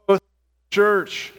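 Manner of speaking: drawn out, stressing "growth"; emphatic. Acted. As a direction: land "growth in the church" as the payoff. Emphasising the result in sermon.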